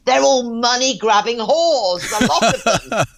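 high, slow mock rant; imitating a bitter client's outburst; grumpy rant. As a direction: rail against women, hammed up. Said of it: "He said".

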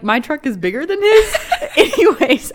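high, loud laughing. Real, not acted. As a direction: crack up at the brag. Reading friend's brag, cracking up.

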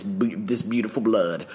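ordinary talk, nothing marked

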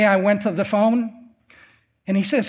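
normal talking voice

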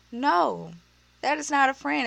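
ordinary delivery

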